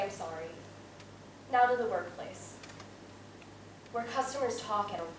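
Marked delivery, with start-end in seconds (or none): 0.0-0.5 much lower, much softer "I'm sorry"; deflated mumble. Acted. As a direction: let "I'm sorry" sag out of you. Bored, attention failing.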